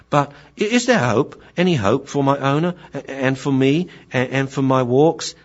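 high, halting, hopeful pleading; pleading young dog. Acted. Grasshopper asking Sensei if there is hope, high halting plea. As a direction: stammer anxiously for some hope. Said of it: "see what Grasshopper has to say".

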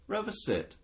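a high, sing-song cue to the dog; owner's command. Retold or imitated. Owner cueing Rover to sit, high and sing-song.

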